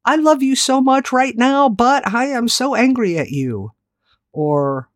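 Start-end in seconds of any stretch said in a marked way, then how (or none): 0.0-3.7 higher, mock-sweet scolding mom; a mom holding back her anger; mother's upset voice. Mom scolding her child, higher and tense. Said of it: "Did you ever hear her say something like".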